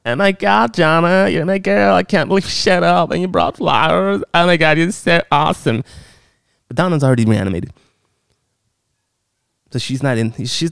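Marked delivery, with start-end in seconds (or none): 0.1-5.8 mock excited Norma. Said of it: "it's like".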